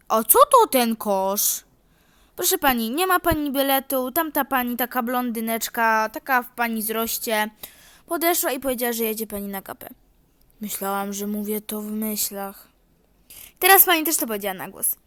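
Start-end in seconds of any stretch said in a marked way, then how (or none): 0.1-1.6 slow, loud playing dumb; mock naive old lady; feigned ignorance. 13.6-15.0 fast, louder gotcha; rapid retort. Acted. Conductor catches her.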